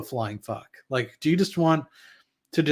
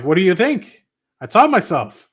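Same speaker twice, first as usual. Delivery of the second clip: exasperated retort; 6 semitones higher, 8 dB louder than usual. Retold or imitated. Retelling his own retort.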